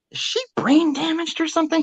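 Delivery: high, disbelieving blurt; incredulous question. Retold or imitated. To the doctor about her daughter, high disbelief. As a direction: ask it like you can't believe it.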